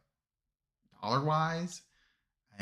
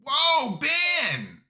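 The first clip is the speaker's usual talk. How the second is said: loud, high burst of delight; excited shout; 11 dB louder than usual. Real, not acted. Streamer thrilled by memberships, shouts out the gifter. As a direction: yelp his name in thrilled surprise.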